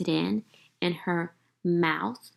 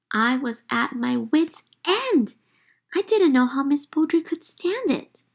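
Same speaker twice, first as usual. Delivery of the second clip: put-on exasperated child; 6 semitones higher, 5 dB louder than usual. A narrator's reading.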